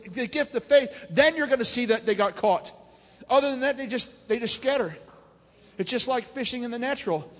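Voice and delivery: rousing, rhythmic church exhortation; fervent preaching. Real, not acted. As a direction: preach it with building force to the congregation. Preaches at full force to congregation.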